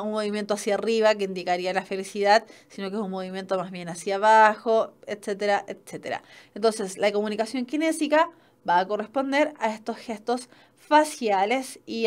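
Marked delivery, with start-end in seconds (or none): 5.7-6.2 fading whisper. Real, not acted. Teacher trailing off at the end of a list, whispered. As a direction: drop to a breathy trailing whisper.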